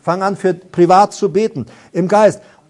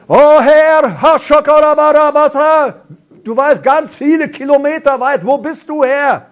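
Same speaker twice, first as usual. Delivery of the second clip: high, loud mock cry to a distant Lord; hammed-up shouting prayer, voice pitched up; 9 semitones higher, 4 dB louder than usual. Mocking people who shout to God as if he were far away, loud and high. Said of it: "Manchmal denken Leute".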